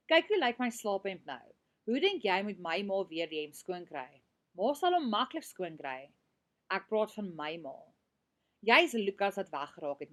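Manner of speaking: reading a story aloud. Acted. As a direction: read the argument out to the class with energy. Reads argument scene from novel.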